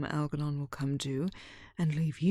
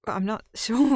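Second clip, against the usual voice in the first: nervous laugh; 3 semitones higher, 8 dB louder than usual. Acted. Nervous laugh mid-reply.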